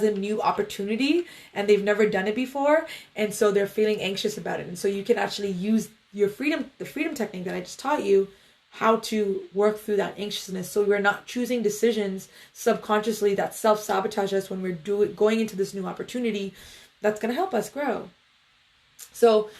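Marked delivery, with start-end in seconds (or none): none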